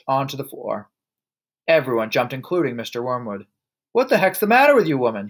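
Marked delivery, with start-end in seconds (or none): 3.9-5.3 high, quick irritated shout. A narrator's reading. Husband to wife after she drops the plate, shouted. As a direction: shout it in a sudden loud burst. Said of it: "he shouted".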